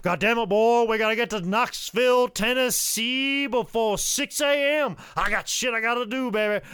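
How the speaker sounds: frantic high-pitched holler; Ricky Morton. Retold or imitated. Ricky hurrying his travel partner to Knoxville, frantic. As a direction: holler it like a wound-up motormouth. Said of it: "Ricky is just a mile a fucking minute".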